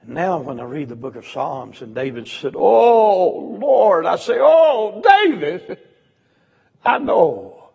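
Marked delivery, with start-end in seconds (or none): 2.6-7.7 loud high cry of a hurting soul; anguished cry. Acted. Preacher crying out with David, loud and anguished. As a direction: wail out to the Lord like David.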